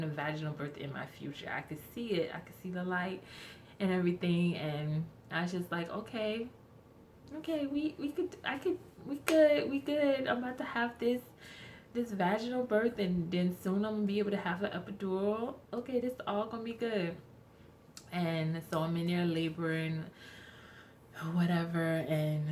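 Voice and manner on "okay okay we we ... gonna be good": telling herself we good, excited; excited self-pep talk. Retold or imitated. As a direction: psych yourself up, thrilled.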